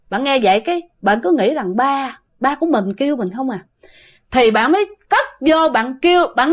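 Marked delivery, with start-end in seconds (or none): none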